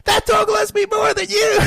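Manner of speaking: put-on high, loud drunken shouting girlfriend; drunk Holly voice. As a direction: belt it out like a tipsy woman demanding a wrestle. Drunk Holly answers back.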